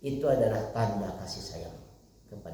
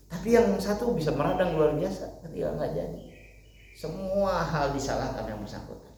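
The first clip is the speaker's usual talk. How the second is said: ordinary delivery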